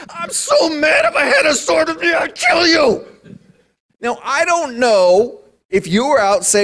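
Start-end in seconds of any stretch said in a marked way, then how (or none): 0.1-3.0 hammed-up fury, higher and fast; mock angry Balaam. Balaam rages at his talking donkey, hammed-up fury. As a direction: rant like a man ready to kill. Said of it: "he's so mad".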